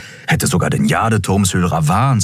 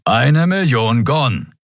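growled insistence, slow and higher; slow, raised, growly demand; Keru; 8 semitones higher, slower than usual. A narrator's reading. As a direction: stretch it out as a stubborn growl. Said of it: "knurrte Keru".

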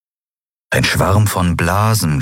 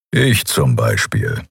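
low, slow grumbling voice; brummend; 6 semitones lower, slower than usual. A narrator's reading. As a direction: grumble it, deep and unimpressed. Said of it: "brummte Keru". (tiefes, langsames Brummen)

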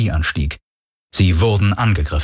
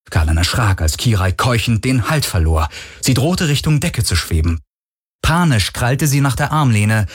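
usual speaking voice

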